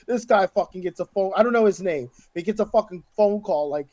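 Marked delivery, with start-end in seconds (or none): none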